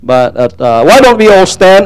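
ordinary delivery

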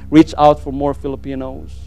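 normal talking voice

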